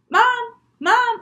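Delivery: high, loud searching call; calling for Mom. Acted. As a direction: call out for a mother who isn't answering. Child calls mother on phone.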